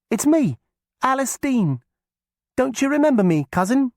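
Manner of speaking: put-on high, loud girl's call; young girl voice. A narrator's reading. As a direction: call out eagerly to old cousin. Alice greets the witch.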